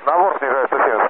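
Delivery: ordinary talk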